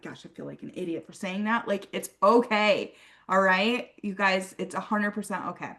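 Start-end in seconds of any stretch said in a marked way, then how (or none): 0.0-1.7 embarrassed aside. Retold or imitated. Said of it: "And you're like".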